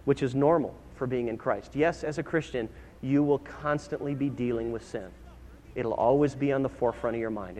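hushed, earnest counsel from the pulpit; gentle reassurance. Real, not acted. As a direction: reassure them quietly and sincerely. Preacher consoles congregation about struggle.

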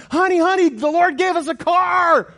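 high, loud, thrilled shout to his wife; excited shout. Retold or imitated. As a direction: burst in with the news. Running in to tell wife.

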